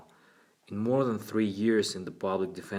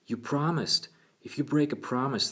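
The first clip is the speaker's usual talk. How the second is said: put-on higher-pitched childlike protest; pleading little boy; 6 semitones higher than usual. A narrator's reading. Boy holding psychologist to her promise, high pleading.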